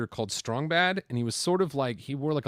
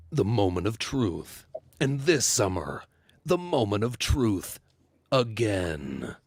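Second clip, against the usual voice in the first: movie trailer voice; 4 dB louder, slower than usual. Pretend. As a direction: announce it like an epic film trailer. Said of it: "like a movie?".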